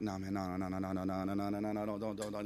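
low, soft stream of refusals; retold dread, hushed and droning; muttered panic. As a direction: mumble a run-on plea under your breath. Dreading stranger coming back.

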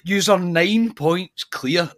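high, slow, disbelieving "yous are nine points clear"; telling a Celtic fan the obvious, high and slow; incredulous retort. Retold or imitated. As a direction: stretch it out in mock disbelief at the fan. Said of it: "And I'm like".

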